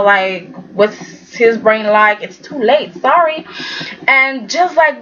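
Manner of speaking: ordinary talk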